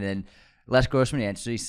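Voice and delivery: usual speaking voice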